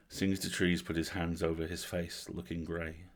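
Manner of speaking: plain reading voice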